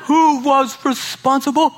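hammed-up devil barking at his minions; mock devil voice. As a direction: play the devil as a squeaky boss. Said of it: "I don't know why the devil talks like that".

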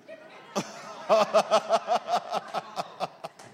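long staccato belly laugh; rapid run of ha-ha-ha; hearty laugh. Real, not acted. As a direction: burst out laughing at your own story. Laughs at dad's punchline.

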